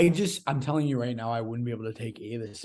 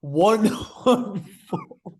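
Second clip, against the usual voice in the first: incredulous; 8 semitones higher, 6 dB louder, slower than usual. Real, not acted. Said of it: "Are you kidding me?".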